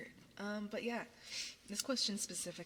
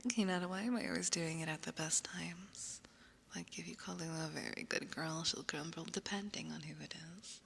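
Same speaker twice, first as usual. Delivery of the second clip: whisper. Real, not acted. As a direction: breathe every word, no voice.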